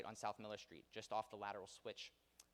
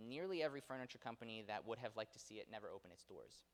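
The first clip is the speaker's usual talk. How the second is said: ordinary delivery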